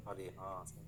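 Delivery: hushed, low student murmur; student catching on. Acted. Student seeing the teacher's point, very soft low murmur. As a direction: sink very low and quiet as the student.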